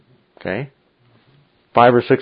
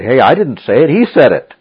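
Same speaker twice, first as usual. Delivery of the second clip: loud, higher-pitched acted disclaimer; protesting retort; 4 semitones higher, 8 dB louder than usual. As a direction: push the blame away, raised voice. Acts out believer's retort to objectors.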